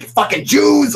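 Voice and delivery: loud high mock of a bigot's voice. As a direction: parody the hateful poster with a loud, high jeer. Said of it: "It's like".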